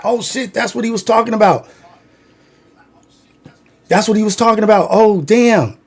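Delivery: high, awed realization; stunned realization. Retold or imitated. Boys seeing what their friend described, stunned realization. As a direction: it finally dawns on you. Seeing the same thing later.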